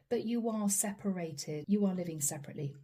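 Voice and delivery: normal talking voice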